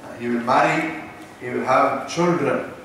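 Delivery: normal talking voice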